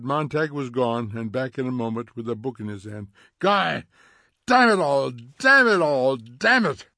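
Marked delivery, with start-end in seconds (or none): 3.4-3.8 high, loud cry of his name; alarmed call. A narrator's reading. Mildred sees her husband's book, calling out sharply. 4.5-6.9 furious swearing. A narrator's reading. Montag bursts out at wife and guests, furious.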